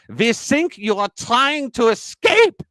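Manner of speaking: put-on high, slow German-accented punchline; suspicious mock German officer, high and drawn out. German captor accuses pilot of escaping, high German-accented outburst.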